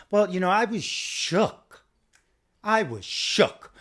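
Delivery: drawn-out hammed-up I was shook; mock Kathy shook. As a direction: overdramatic imitation of a frightened woman. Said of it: "how she was".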